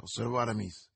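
usual speaking voice

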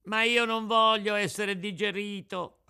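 high, loud wail of protest; tearful yell. A narrator's reading. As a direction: yell it, starting to cry, acted. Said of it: "urlò Pinocchio ricominciando a piangere".

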